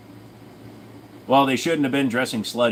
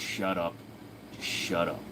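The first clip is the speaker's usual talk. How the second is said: low, soft deadpan dad; low quiet flat dismissal; tired dad; 8 semitones lower, 12 dB quieter than usual. Retold or imitated. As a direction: mutter it like a worn-out father. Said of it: "the dad just sitting there goes".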